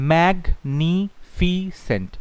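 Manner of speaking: teacher modelling the right way; slow correct pronunciation. Shown as a demonstration. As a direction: draw out magnificent carefully. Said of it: "কথা হচ্ছে magnificent".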